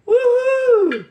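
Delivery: high, loud joyful woohoo; excited whoop. Real, not acted. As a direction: cheer it out like you nailed it. Cheering over the rice.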